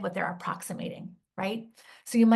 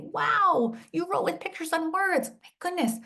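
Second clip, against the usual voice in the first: put-on much higher, delighted wow to a child; excited praise; 9 semitones higher than usual. Shown as a demonstration. Teacher praising a writer who catches first sounds, excited. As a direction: marvel at the child's writing. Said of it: "I might say".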